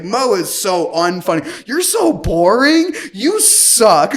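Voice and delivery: high, loud mock heckling; retold screaming. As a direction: mimic a date yelling insults, stretched for effect. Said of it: "screaming it too".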